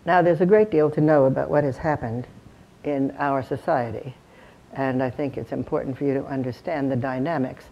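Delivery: low, subdued, detached lecture delivery; distant, isolating lecturer. Shown as a demonstration. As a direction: talk from afar, without the energy or connection. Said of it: "Suppose I were to do it this way".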